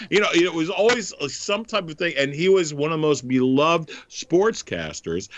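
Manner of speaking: normal talking voice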